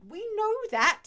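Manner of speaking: high, loud burst of impatience; exasperated interjection. Real, not acted. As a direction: cut in loudly, fed up. Exasperated interruption of own reading.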